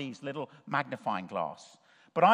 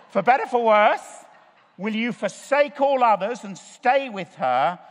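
"For better, for worse" called out much louder and higher; a hammed-up wedding-vow boom; 6 semitones higher, 9 dB louder than usual. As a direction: proclaim the vow to the groom. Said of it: "But I said".

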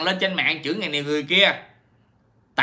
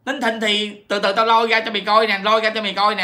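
mock threat as Hằng; 6 semitones higher than usual. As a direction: imitate a defiant online threat. Voices Hằng threatening the singer. (giọng nhại chị Hằng đe dọa)

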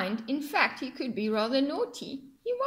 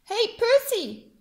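high, loud, slow call; crab calling out; 9 semitones higher, 5 dB louder than usual. Acted. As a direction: hail Percy from across the reef. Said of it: "cried the hermit crab".

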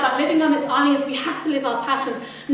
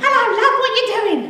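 put-on high-pitched, exuberant old man; exaggerated impression of a lively ninety-year-old; Peter Lawler; 8 semitones higher, 4 dB louder, faster than usual. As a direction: greet a friend in a big, high old-timer's voice. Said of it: "that's how he speaks".

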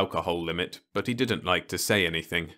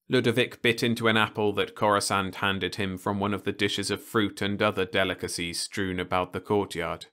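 ordinary delivery

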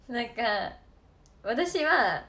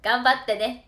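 put-on louder, beaming old farmer cheering her on; a smiling old man saying good luck; kindly encouragement; 10 dB louder than usual. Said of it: "すごいニコニコしながら". (ニコニコしたおじさんの励まし)

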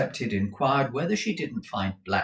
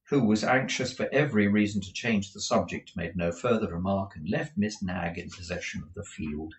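usual speaking voice

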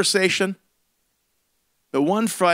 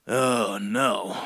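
mock dread; 11 semitones lower, 6 dB quieter than usual. Dread at the naked guy.

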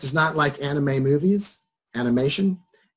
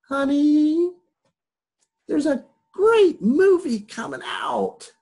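put-on high, slow, pleading child voice; childish wheedling to win his wife over; acting like a kid; 13 semitones higher, slower than usual. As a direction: whine it up high like a little kid begging mom. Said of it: "I'm acting like a kid".

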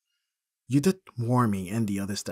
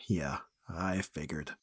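softer, whispered resignation; resigned sigh; 5 semitones lower, 6 dB quieter, slower than usual. Acted. Sighing agreement with the friend, soft and resigned. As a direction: let it out on a sigh.

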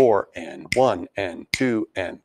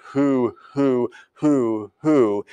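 owl hoo; much slower than usual. Retold or imitated. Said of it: "like you're an owl saying".

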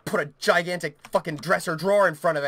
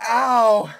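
pained; 3 semitones higher, 8 dB louder than usual. Real, not acted. Pained by pulled muscles.

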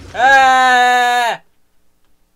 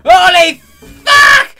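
slow, loud stretched shout; shocked shout; 13 semitones higher, 9 dB louder, slower than usual. Real, not acted. Gamer yells when a monster leaps out. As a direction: yell it out in shock.

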